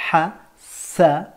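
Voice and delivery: hasanat very slow, split ha - sa - naat; découpé en syllabes. Shown as a demonstration. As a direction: sound out hasanat one syllable at a time. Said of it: "ce que tu entends dans le mot". (hasanat syllabe par syllabe, très lentement)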